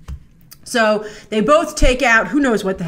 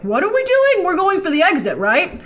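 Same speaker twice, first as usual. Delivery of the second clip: much higher, worried questioning; mock panicked soldiers; 8 semitones higher, 4 dB louder than usual. Soldiers in the back nervously asking about the exit. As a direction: squeak it like nervous extras. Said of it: "everyone there in the back is like".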